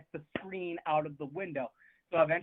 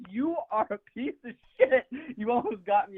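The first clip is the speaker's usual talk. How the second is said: higher, mock-outraged comeback; retold outrage; 7 semitones higher than usual. Replying to her apology text, in mock outrage. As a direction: throw a hammed-up accusation in a raised pitch.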